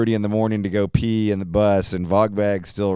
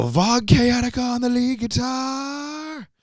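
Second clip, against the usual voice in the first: much higher, slow drawn-out stage intro; stage announcer; slower than usual. Pretend. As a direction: announce him to the crowd. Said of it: "when you're on stage, you go".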